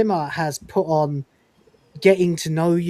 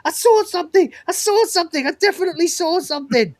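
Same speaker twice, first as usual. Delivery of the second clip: high, louder frightened insisting; mock panic; 12 semitones higher, 3 dB louder than usual. Crewman insisting he saw something, mock frightened high voice. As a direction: repeat it like you can't believe your eyes, hammed up. Panicked sighting in retold scene.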